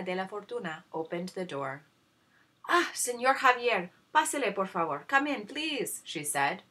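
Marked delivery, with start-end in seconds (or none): 2.7-6.0 fawning stepmother. Acted. Stepmother fawning over suitor.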